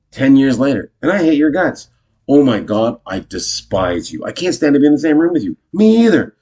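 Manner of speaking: high, loud, relishing the insults; hammed-up delighted venting; gleeful mock loathing. Acted couple gleefully trading hatred.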